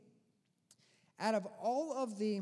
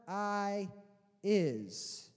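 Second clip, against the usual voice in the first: solemn divine voice. Acted. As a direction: let the word sink like a proclamation. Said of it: "it literally means".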